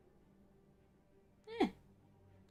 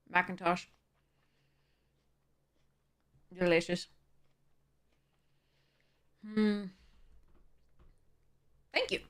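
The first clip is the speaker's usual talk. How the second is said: chewing apple; 3 semitones lower, 5 dB quieter than usual. Real, not acted. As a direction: mouth full of crunchy apple. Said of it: "Sorry about the crunching in the ear".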